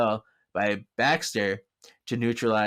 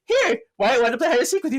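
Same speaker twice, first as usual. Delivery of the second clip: fast, much higher, louder sugary voice; sweet coaxing; 12 semitones higher, 5 dB louder, faster than usual. Retold or imitated. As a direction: sell it sweetly and quickly. Piper coaxing Leo.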